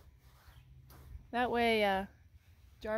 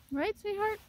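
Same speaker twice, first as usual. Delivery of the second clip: very high, sweet cooing; pet talk; 13 semitones higher, 3 dB louder than usual. Real, not acted. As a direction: coo it to the horse. Cooing to the horse.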